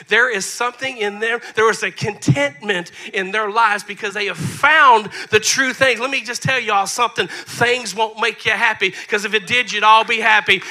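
loud, emphatic preaching. Real, not acted. Pastor telling church things won't make them happy, full-force preaching. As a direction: build to a forceful punch for the congregation.

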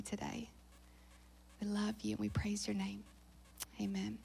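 very soft, breath-light praying; hushed prayer. Real, not acted. Preacher leading the gathering in prayer before the message, hushed and reverent. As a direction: sink almost to a whisper, speaking intimately to the Lord. I just wanna pray.

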